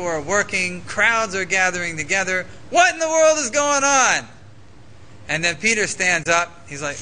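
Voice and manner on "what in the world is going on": raised, high-pitched exclamation of bewilderment; baffled crowd member exclaiming; bewildered outcry. Acted. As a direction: cry out over the confusion, higher and a bit louder. Voices the bewildered crowd's reaction.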